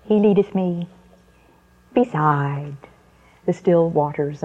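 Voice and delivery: lightly voiced toddler reciting, with an odd sing-song intonation; a hint of a two-year-old saying her psalm word by word; mother imitating her small daughter reciting a psalm, lightly childlike; tiny baby voice. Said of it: "that tiny little baby voice saying".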